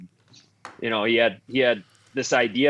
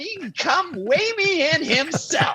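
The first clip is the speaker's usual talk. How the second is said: Phil Kessel voice; 14 semitones higher than usual. Retold or imitated. Phil yelling at the coach from the change room, high mock scream. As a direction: shriek it down the hall in a high put-on voice. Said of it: "Screamed it down the hallway".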